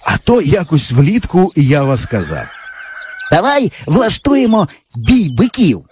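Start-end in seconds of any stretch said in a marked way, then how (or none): 3.3-5.8 put-on higher eager boy voice; boyish friend. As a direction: brag like a cocky village boy. Java proposes bullfight.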